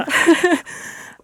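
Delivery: a bright burst of real laughter, high and breathy; amused laugh. Real, not acted. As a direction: laugh at the idea of a shameless plug. Amused by shameless plug request.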